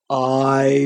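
drowsy, dragged-out creature voice; very slow. Shown as a demonstration. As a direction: repeat it very slowly, half asleep. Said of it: "very slowly indeed".

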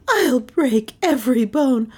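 high, heated threat; angry threat. Acted. Melissa threatening the one who woke her, angry. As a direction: threaten with the pitch pushed up.

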